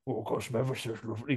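lightly voiced possessed creep, fast; a hint of a creepy possessed voice; demonic double voice. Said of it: "'cause he's got a, got a possessed voice".

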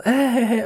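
high, retold uneasy "ah, ah, ah"; mock nervous laugh. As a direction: play at stalling with high little noises. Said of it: "jeg begynder stille og roligt at panik". (spillet nervøst grin)

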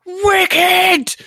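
high-pitched, gleeful squeal; over-excited Ron. Retold or imitated. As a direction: blurt it like an overexcitable boy, stretched out. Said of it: "Ron goes".

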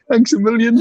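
high, loud giggly thanks a million; laughing mock thanks. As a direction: repeat the line cracking up with laughter. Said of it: "And then".

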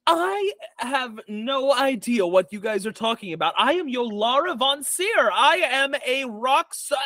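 high, indignant diva proclaiming herself; Yolara. Acted. Yolara denying everything to her accusers, indignant diva voice. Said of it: "And then Yolara, uh, says".

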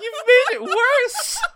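very high, loud, drawn-out wail; upset squeak. Real, not acted. Friend protests the reverse corn dog idea, upset squeak. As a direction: squeal the accusation slowly through laughter. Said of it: "my voice is becoming a squeak".

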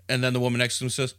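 normal talking voice

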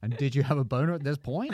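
cross-examine like a barrister, barely holding back laughter; hammed-up barrister's question; mock defence barrister. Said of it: "barristers say it to me because it's part of the defense".

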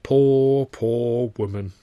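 slow, drawn-out sorrowful poor, poor woman; pitying. A narrator's reading. Landlady mourning the pale neighbour, slow and pitying. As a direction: linger on each poor with pity.

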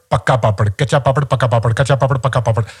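"pakka papad, kacha papad" rattled off in Hindi style; fast Hindi tongue twister demo. Shown as a demonstration. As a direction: run "pakka papad, kacha papad" quickly with crisp k and p. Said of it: "hard to pronounce the word kacha papad".

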